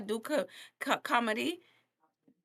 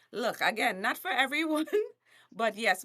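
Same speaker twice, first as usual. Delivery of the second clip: ordinary talk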